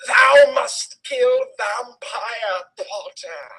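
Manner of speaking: slow, strange dark-lord voice; villain voice. Acted. Reading Voldemort ordering Ebony, slow villain voice. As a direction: drawl the command like a storybook villain.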